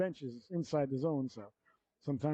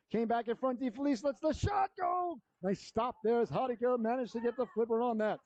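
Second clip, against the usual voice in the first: higher, urgent call of the shot; excited call; 7 semitones higher than usual. Real, not acted. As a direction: rise up for the scoring chance. Scoring chance call.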